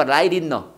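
"Light in 너" with the T softened to a Korean ㄹ, "라이린너"; T as flap. Shown as a demonstration. Teacher shows students the T is not hard, flapped pronunciation. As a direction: turn the T of "Light" into a tapped R before "in 너". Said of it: "이거 리을로 발음한다".

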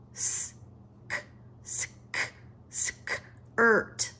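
Sk- and sk- whispered, then skirt said aloud; segmented word. Shown as a demonstration. Teacher to class: blends skirt sound by sound, whispered onsets. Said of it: "phonics blending of word".

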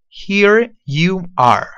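"Here you are" stretched very slowly, falling; very slow model. Shown as a demonstration. Teacher slowly modeling "Here you are". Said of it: "¿Qué significa here you are?".

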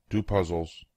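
usual speaking voice

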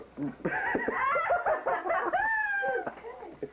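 high squealing laugh burst; shrieking laugh. Real, not acted.

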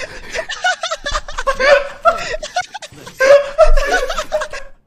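long, high laughing fit; helpless laughter. Real, not acted. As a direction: crack up in high peals of laughter. Laughing after own yell.

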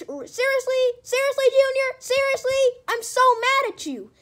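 high, loud, furious whine; high, loud angry complaint; angry outburst. Acted. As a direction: blow up at Junior. Yoshi angry at Junior.

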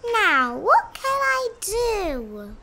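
high, slow, drawn-out musing; pondering rabbit. A narrator's reading. Rabbit searching for a way to prove himself, musing. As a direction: wonder it aloud slowly. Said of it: "thought the rabbit".

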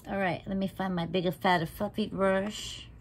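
usual speaking voice

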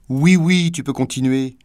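high, loud mock approval; ironic. A narrator's reading. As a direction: sneer out a sarcastic go-ahead. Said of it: "lui dit-elle ironiquement". (ironiquement, plus haut et plus fort)

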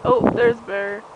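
normal talking voice

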